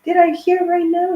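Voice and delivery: can't believe what you just heard; startled disbelief. Retold or imitated. Vlogger reliving hearing a strange voice, shocked and high. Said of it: "I was like".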